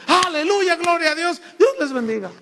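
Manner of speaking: loud, high jubilant praise; preacher's hallelujah. Retold or imitated. Preacher praising God after the altar call, loud and joyful. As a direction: cheer it like a triumphant pulpit.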